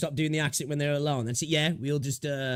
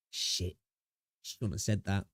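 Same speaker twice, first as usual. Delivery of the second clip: muttered regret; 5 semitones lower, 6 dB quieter, slower than usual. Retold or imitated.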